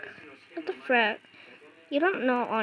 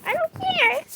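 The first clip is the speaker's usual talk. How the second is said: high, put-on taunting voice; silly defiant squeak; 13 semitones higher than usual. Player taunting the monster he annoys on purpose, squeaky defiance. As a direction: toss it off in a cartoonish high voice.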